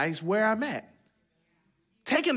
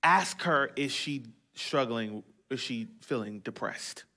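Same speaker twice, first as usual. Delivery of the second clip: soft inner voice; 8 semitones lower, 6 dB quieter than usual. Retold or imitated. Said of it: "Holy Spirit speak to me and say".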